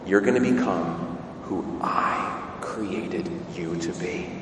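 hushed low voice with weighty pauses; Jesus. Acted. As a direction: speak as the Lord, quietly and deliberately. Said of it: "here's where Jesus tells us".

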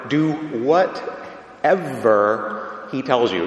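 "whatever" set apart and stressed hard. Shown as a demonstration. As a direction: pause after "Do", then punch "whatever". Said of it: "put emphasis on the word whatever".

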